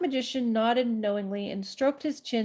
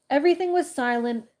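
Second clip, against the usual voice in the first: plain reading voice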